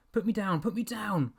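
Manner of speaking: put-on a boy dangling in the dog's jaws; panicked. A narrator's reading. Lifted by dog, panicking.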